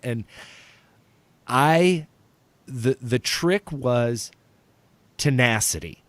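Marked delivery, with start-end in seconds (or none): none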